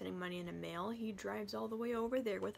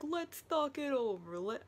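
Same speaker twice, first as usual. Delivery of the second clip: lightly imitated Russian accent, pitched up; 4 semitones higher than usual. Man being shot bargains with Humbert, Russian accent. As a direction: plead in a put-on Russian accent. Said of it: "I imagine it with a Russian accent".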